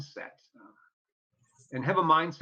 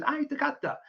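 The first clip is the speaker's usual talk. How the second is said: proud; 12 semitones higher than usual. Retold or imitated. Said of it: "And I said".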